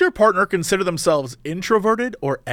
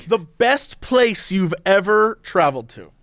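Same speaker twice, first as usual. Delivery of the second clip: 1 slow deliberate repeat; slower than usual. Real, not acted. Repeats question slowly over crosstalk.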